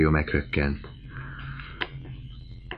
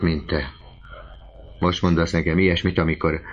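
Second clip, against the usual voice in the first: plain reading voice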